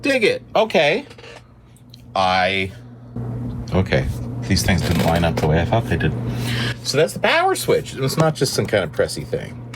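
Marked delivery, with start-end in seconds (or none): none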